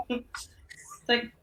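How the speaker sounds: ordinary delivery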